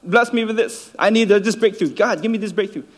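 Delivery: crying out. Retold or imitated. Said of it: "whatever he cries out".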